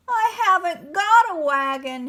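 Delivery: high, loud wailing protest. A narrator's reading. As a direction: wail the complaint. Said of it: "Hector protested, wailing loudly".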